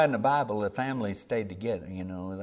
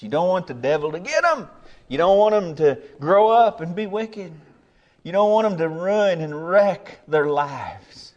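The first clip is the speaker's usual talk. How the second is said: raised, high-pitched warning from the pulpit; urgent preaching; 7 semitones higher, 8 dB louder than usual. Real, not acted. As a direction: cry out a warning to parents.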